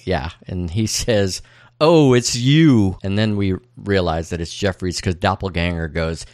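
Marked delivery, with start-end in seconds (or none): 1.8-3.0 slow, a bit higher and louder, like a machine speaking; lightly voiced machine, drawn out; a hint of a robotic device voice; mock metallic voice. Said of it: "speaks in a metallic-sounding voice".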